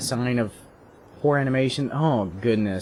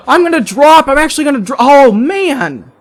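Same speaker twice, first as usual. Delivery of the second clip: much higher, loud outburst; excited yell; 13 semitones higher, 10 dB louder than usual. Real, not acted. Player yells as he is about to drop, high excited shout. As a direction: yell it out high, cutting yourself off. Player panics over dropping in-game.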